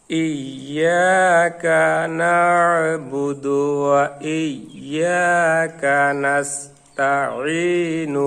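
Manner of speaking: very slow, drawn-out Quran recitation; slow joined recitation. Shown as a demonstration. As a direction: join the whole verse, stretching the long vowels. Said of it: "আস্তে আস্তে করে". (আস্তে আস্তে টেনে তেলাওয়াত)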